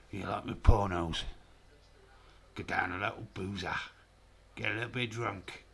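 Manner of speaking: put-on regional character voice; mock impression, thickly accented; James Chadwick. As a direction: impersonate a boozy lad with an accent. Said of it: "I could do a James Chadwick impression".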